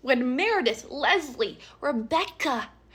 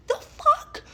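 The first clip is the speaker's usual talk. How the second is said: much higher, cracking outraged disbelief; shocked outburst. Real, not acted. Narrator reacting to the lie with shocked outrage, very high. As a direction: blurt out in shock.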